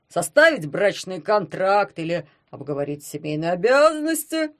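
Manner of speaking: a hint of a pompous lecturer; prim and preachy, clearing throat; stuffy lecturing husband. A narrator's reading. Poklep voiced by narrator. (чопорный зануда)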